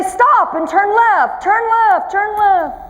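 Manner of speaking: mock navigation voice, a touch mechanical; GPS voice. Preacher mimics one car GPS giving directions, mild mock GPS voice. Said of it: "one of the G P S would say".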